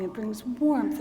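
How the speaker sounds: ordinary delivery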